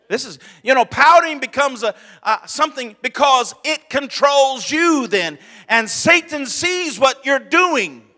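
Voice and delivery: fiery sermon shout; full-force preaching. Acted. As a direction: drive the warning home from the pulpit.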